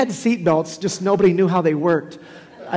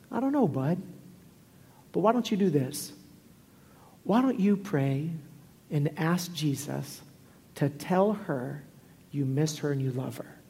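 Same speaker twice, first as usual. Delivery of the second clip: gentle fatherly voice; 6 dB quieter than usual. Retold or imitated. Dad soothes crying son at night.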